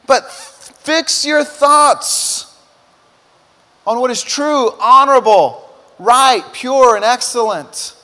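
very slow, loud, high, each word drawn out; climactic slow proclamation. Shown as a demonstration. Pastor proclaiming the verse to the congregation, slow and loud. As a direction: preach the verse as the peak.

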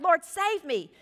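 crying out. A narrator's reading. Peter cries to Jesus as he sinks, mild cry out. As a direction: call out with a touch of urgency. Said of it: "he cried out".

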